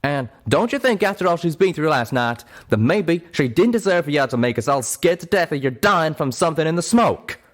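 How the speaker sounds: loud, high reproach; reproachful anger. Acted. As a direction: make her feel the guilt. Calamity guilt-trips Pip.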